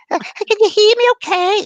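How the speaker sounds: very high, loud Scottish lady; piping Scottish question, way up; Mary. Retold or imitated. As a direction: call out like a Scottish spirit lady. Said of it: "I got it good for you".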